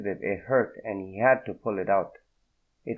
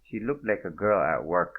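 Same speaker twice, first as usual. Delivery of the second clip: ordinary delivery, nothing marked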